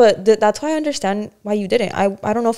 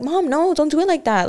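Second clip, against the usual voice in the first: higher, exasperated pushback at mom; frustrated retort; 7 semitones higher than usual. Retold or imitated. Daughter quoting her impatient retort to mom, exasperated. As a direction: snap back at mom, irritated.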